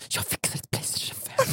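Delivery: whispered conspiratorial offer; mock whisper. [name] pretending to whisper a bribe to the mother. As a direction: whisper the promise of "Playstation fem". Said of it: "viskar tillbaka så här".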